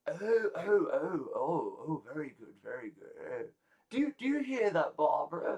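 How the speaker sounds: high, swooping oohs of delight; delighted party guest. Acted. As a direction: coo in a high guest voice, then call to a companion.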